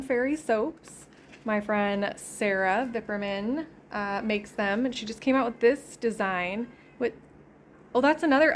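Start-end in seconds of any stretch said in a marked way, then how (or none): none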